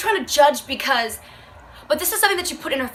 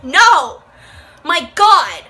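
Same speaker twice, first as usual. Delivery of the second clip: exasperated no; 3 semitones higher, 8 dB louder, much slower than usual. Real, not acted. Exasperated disbelief.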